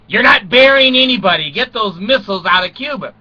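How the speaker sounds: mock firm rebuke. Kennedy to Khrushchev, a loud mock rebuke. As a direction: shut him down hard.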